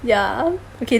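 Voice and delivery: normal talking voice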